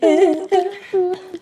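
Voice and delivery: a bright burst of laughter; laughing. Real, not acted. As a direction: laugh at the singing. Laughing after the song.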